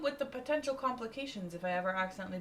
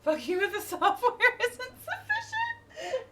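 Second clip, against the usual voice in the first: mock defiant outburst; 8 dB louder, slower than usual.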